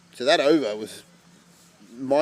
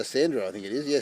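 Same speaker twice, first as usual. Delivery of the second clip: usual speaking voice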